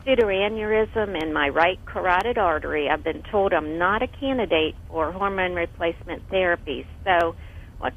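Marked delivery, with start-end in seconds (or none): none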